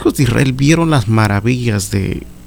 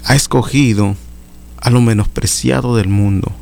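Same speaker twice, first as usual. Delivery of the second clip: high, impassioned sermon delivery; fervent preaching; 4 semitones higher than usual. Acted. Preacher urging brothers to answer God's call, impassioned high preaching. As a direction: lift the congregation, preach with fervour. Preacher exhorting at full force.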